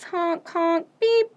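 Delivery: usual speaking voice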